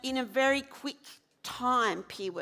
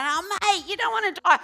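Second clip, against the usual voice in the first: insistent plea; 9 semitones higher, 5 dB louder than usual. Retold or imitated. Pleading with suicidal man again.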